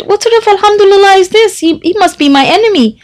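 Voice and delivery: anxious. A narrator's reading. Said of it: "the sick person became anxious".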